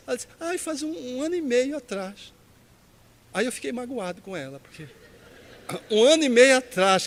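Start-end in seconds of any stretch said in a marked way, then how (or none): 0.4-2.3 higher-pitched imitation of a disheartened caller; mulher desanimada, imitada. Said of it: "ela foi ficando meio desanimada". 5.9-7.1 loud, higher echo in disbelief; retold disbelief. Repeats her answer in disbelief.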